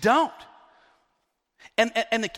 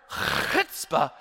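chutzpah with a long guttural Hebrew "ch"; Hebrew accent; 3 semitones lower than usual. Shown as a demonstration. Preacher citing the word in the text, Hebrew accent. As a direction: rasp the Hebrew opening of chutzpah in the throat. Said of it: "It's the Hebrew".

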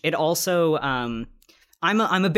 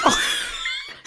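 airy squeal of laughter; high breathy laugh. Real, not acted. Laughs at victim blaming.

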